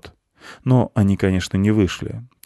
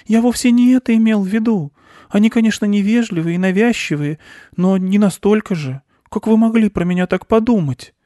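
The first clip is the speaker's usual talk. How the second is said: high, loud flustered mouse protest; aghast little mouse; horrified squeak; 14 semitones higher, 5 dB louder than usual. A narrator's reading. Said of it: "в ужасе пискнул мышь".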